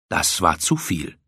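plain reading voice

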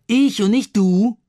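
put-on high, loud and slow, a boy protesting; indignant child voice. A narrator's reading. Ben protests to his brother in a high, loud child voice. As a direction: raise your voice like a put-out little boy.

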